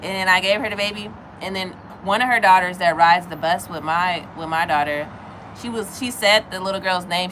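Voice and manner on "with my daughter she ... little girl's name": ordinary talk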